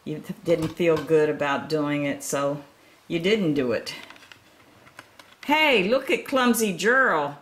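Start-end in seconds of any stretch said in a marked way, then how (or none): 5.4-7.4 loud, high, mocking jeer; sneering. Animals jeering at clumsy Gerald, loud sneer. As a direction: taunt him from the crowd. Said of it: "the animals all sneered".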